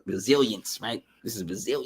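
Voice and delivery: ordinary delivery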